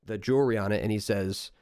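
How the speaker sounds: ordinary delivery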